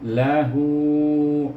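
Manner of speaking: Lahu with long hu; loud stretched Lahu; over enunciating. Shown as a demonstration. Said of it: "hu panjang dua harakah".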